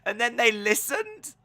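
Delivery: mock disbelief. Acting out disbelief at crowd's silence.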